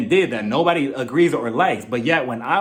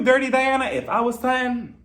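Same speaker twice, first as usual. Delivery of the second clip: high calling out; 8 semitones higher than usual. Retold or imitated. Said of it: "As for you".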